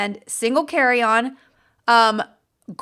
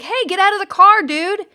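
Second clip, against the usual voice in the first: calling out; 6 semitones higher, 5 dB louder than usual. Retold or imitated. Retold crowd calling to the man.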